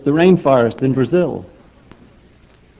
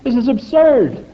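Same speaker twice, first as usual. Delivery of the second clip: scientists scoffing; 8 semitones higher than usual. Retold or imitated. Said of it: "speaking out and saying".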